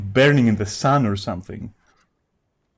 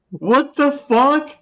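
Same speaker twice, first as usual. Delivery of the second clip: much higher, louder and slow, stunned; shocked; 13 semitones higher, 5 dB louder, much slower than usual. Real, not acted. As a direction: drag it out in squeaky outrage. Shocked by racist chat message.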